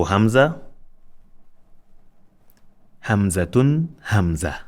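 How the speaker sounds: Hamza, Hamzatun rising, Hamza falling; teacher modelling the Arabic letter Hamza; letter name drill. Shown as a demonstration. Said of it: "This is Hamza".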